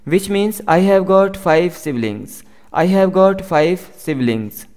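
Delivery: very slow, careful English for learners; slow model sentence. Shown as a demonstration. Teacher reading the example slowly to students. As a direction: spell each word out slowly for the class. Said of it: "teacher model sentence".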